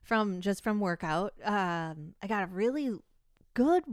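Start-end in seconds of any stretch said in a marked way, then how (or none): none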